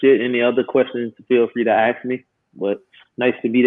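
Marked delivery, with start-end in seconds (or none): none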